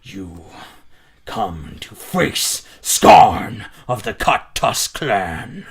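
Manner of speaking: deep, slow, booming warlord; booming orc voice. Acted. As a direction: roar it like a huge orc chieftain. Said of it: "the booming voice once again echo through".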